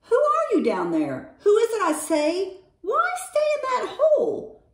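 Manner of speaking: urgent high-pitched demands; insistent pleading. A narrator's reading. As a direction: plead down into a hole, voice climbing high. Bear pleads down the hole.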